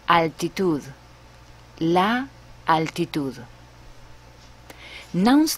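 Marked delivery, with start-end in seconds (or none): 0.1-3.4 la altitud said natively; Spanish pronunciation. Shown as a demonstration. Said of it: "For example".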